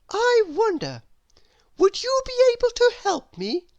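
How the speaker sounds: put-on a curious little girl asking sweetly; little Miss Curious voice. Narrator voices Miss Curious asking her door. Character voice.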